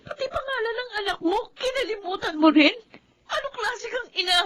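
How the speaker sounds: high, soft voice breaking with tears; tearful, wounded reproach; crying accusation. Acted. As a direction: sob out the hurt at her mother. Daughter accuses mother, crying.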